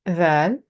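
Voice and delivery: light voiced th opening "ذال"; thin sound. Shown as a demonstration. Teacher models letters for learners, thin sound. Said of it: "When we say the thin sounding letters".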